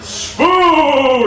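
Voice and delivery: high, drawn-out catchphrase shout; mock battle cry. Fan quoting The Tick's catchphrase, mock heroic cry. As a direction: holler it like a cartoon hero.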